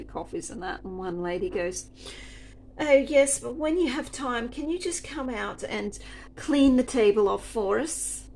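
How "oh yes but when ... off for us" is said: higher and louder, sugary demand; prim customer's request. Retold or imitated. Customer asking the server, in mock-sweet tones. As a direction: put on the pushy customer's sweet voice.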